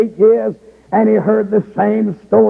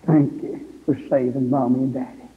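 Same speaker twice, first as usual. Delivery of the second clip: softer, gentle voicing of a small boy; a light hint of a grateful child; quietly imitating a little boy; little boy's thanks; 4 semitones lower, 6 dB quieter than usual. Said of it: "He said it like this".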